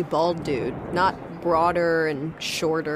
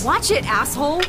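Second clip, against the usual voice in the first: angry shout; 8 semitones higher, 5 dB louder than usual. Acted. Rescue shouts on stormy dock.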